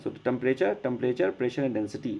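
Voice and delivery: usual speaking voice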